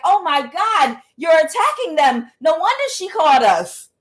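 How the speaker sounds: high, exclaiming mock horror; shocked onlookers. People arriving to see you whooping them, shocked.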